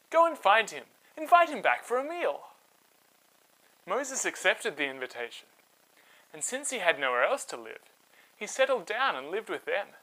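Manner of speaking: put-on lively read-aloud voice for kids; storybook narration. A narrator's reading. Host telling children a Bible story, animated narration.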